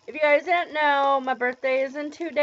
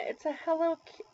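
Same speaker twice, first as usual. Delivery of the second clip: ordinary delivery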